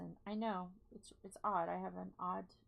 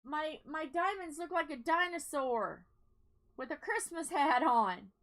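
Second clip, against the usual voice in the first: excited; 5 semitones higher, 9 dB louder than usual. Real, not acted. Excited, slow, loud description.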